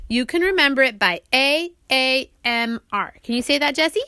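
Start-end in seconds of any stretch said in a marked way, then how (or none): none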